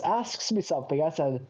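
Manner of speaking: usual speaking voice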